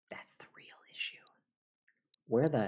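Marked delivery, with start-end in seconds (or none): 0.1-1.2 whispered aside; hushed conspiratorial whisper. Real, not acted. Aside after rant.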